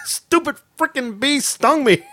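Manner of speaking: high, irritated snap back at dad; stung son snapping at dad, high; exasperated retort. Retold or imitated. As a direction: bite back in pain. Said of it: "reply to dad".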